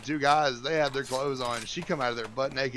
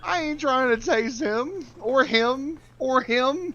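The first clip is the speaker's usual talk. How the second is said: mock high voice; 12 semitones higher, 6 dB louder, much slower than usual. Joking refusal to share blunt.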